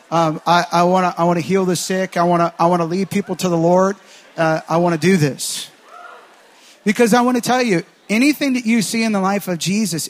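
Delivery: loud, driving preacher cry; preaching at full force. Acted. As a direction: push it out to the whole room, full conviction. Pastor rallies standing congregation.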